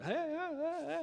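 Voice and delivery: high panicked cries from the river; mock a man swept downstream shouting; yelling for help. Man swept down the river, high panicked cries.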